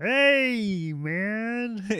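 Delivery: exaggerated celebrity imitation, long vowels; Ray Romano. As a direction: stretch each word in a high put-on voice. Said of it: "that's my best friend".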